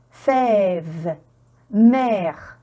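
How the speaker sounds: "fève", "mère" with long open è; prolonged è. Shown as a demonstration. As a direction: hold the vowel in "fève" and "mère". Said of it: "il est prolongé".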